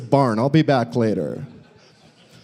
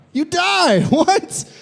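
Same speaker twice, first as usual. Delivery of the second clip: mock outburst; much slower than usual. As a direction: yelp it in fake shock. Comic outburst about mushroom death.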